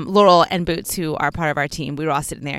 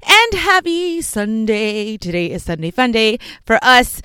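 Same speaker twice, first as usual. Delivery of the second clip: cheerful greeting; 4 semitones higher, 3 dB louder, slower than usual. Real, not acted.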